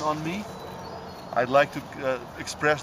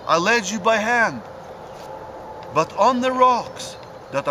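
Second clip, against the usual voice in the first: measured verse reading, higher than casual talk; storytelling poem in lilting, higher-pitched phrases; poem recitation; 6 semitones higher, 3 dB louder than usual. Acted. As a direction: recite rhymed verse line by line, pausing after each. Recites own rhyming poem.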